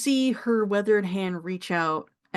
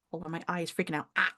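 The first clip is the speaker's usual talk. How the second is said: normal talking voice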